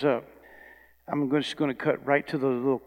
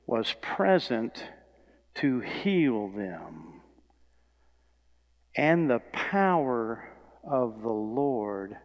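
slow, weighty reading with pauses between phrases; deliberate scripture reading; much slower than usual. Acted. Preacher reads the verse to the church, slow and deliberate. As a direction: let each phrase of the verse land slowly.

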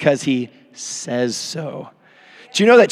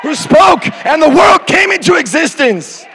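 high, loud revival preaching; preaching shout; 10 semitones higher, 5 dB louder than usual. Acted. Pastor shouting to his church about God's power.

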